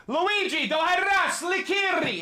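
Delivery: very high commanding cry; shouted dog command. Real, not acted. Owner hits dog with High Valyrian commands to calm her, high shouted command. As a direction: call out sharply in a high pitch.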